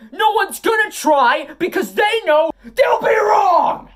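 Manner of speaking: high, strained promo yell; heel yelling. Acted. Wrestler yelling at disgusting people, high and strained. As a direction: rant at the viewers until the voice cracks. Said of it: "my voice is cracking because, like I said, I'm yelling".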